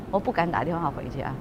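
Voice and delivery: ordinary talk, nothing marked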